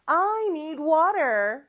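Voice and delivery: long vowels, lifted teacher voice; slow model sentence. Shown as a demonstration. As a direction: model it slowly for repeating. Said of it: "큰 목소리로 따라해볼까요".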